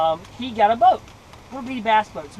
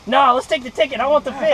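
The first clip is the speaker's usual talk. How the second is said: louder, higher insistent objection; acted eager protest; 4 semitones higher, 7 dB louder than usual. Boy refusing to throw the fish back, loud insistent protest. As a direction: push back like a kid who won't give in.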